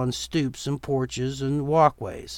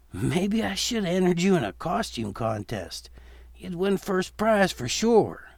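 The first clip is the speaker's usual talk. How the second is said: a hint of a teasing dad, a little higher; gently mocking father figure; lightly voiced father; 6 semitones higher than usual. Father teasing his son about his stiff walk, light and amused.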